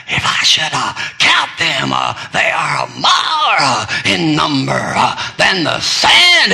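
mock radio preacher hacking and shouting. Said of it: "preaching like that".

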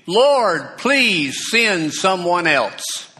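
high, loud, slow desperate plea; pleading. Acted. Moses begs God to send someone else, loud slow pleading. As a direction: Moses begging the Lord, drawn out and high. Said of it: "But Moses pleaded".

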